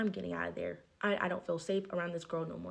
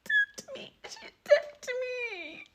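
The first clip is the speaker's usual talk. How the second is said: much higher, slow fake crying whine; mock wailing; slower than usual. Mocking the friend crying she touched me, high fake wail. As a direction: wail it like a dramatic crybaby. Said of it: "she's like".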